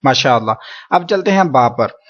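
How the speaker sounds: normal talking voice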